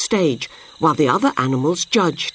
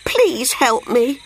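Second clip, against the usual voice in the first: high, slow plea; plaintive trapped monkey; pleading cry; 7 semitones higher, slower than usual. A narrator's reading. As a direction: beg for help, dragging the words out. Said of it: "cried Monkey".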